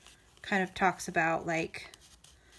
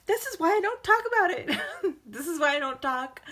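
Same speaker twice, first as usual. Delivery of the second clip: laughing through the words, voice pitched up; embarrassed laugh; 9 semitones higher, 4 dB louder than usual. Real, not acted. Laughing at herself while admitting she gets emotional. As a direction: laugh it off, flustered. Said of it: "because I get emotional".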